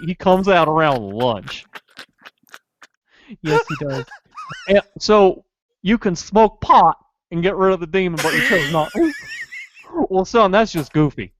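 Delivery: put-on Goofy interrogating the teen suspect, dopey. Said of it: "do the rest as Goofy".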